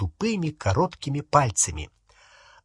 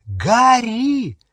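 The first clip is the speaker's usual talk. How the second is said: high, loud call of the name; alarmed cry; 9 semitones higher, 4 dB louder than usual. Acted. Hermione calling Harry, alarmed. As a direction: call out urgently, pitched right up.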